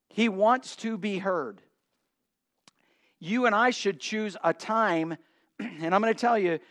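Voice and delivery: ordinary talk, nothing marked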